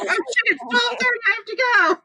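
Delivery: sudden high-pitched alarm, laughing through it; realizing you're late and laughing at yourself; startled panic. Retold or imitated.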